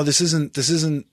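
usual speaking voice

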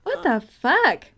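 drawn out high and slow; baffled, stretched-out disbelief; retold bewilderment. Recalling a first viewing at home, bewildered. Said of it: "just being like".

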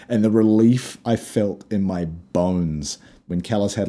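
ordinary talk, nothing marked

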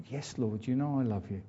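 quiet, contrite devotion; humble reply. Retold or imitated. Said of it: "And Peter said".

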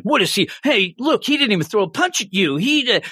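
a light mock taunt; taunting friends. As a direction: jeer like a bully's sidekick. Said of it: "So it just keeps like".

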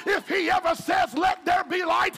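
loud, high, shouted preaching over music; shouted sermon climax; full-force preaching. Acted. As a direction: preach it hard and climb.

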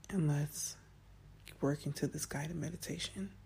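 soft hushed voice; soft spa whisper. Real, not acted. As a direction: ease them in with a hush. Easing into guided meditation.

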